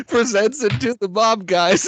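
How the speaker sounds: normal talking voice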